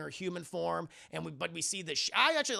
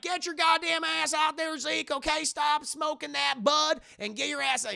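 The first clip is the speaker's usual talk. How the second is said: much higher, louder coach bark; yelling coach; 10 semitones higher, 6 dB louder than usual. Retold or imitated. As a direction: shout the player onto the field. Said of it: "Robert Patrick is like".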